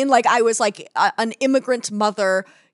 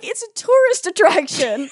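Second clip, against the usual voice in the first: higher, slow, exasperated "tourist attraction"; mock exasperation; 10 semitones higher, 6 dB louder than usual. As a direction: spell out the obvious, slowly and higher. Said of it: "I'm like".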